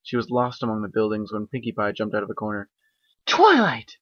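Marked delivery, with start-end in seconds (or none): 3.3-4.0 high, loud excited call; loud high cheerful call. Acted. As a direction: shout her name across the street. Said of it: "Pinkie Pie called out".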